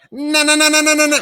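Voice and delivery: high, rapid na-na-na whir; spinning noise. Retold or imitated. Fan shows how the droid should spin, high whirring noise. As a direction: imitate something spinning madly. Imitates a fast-spinning top.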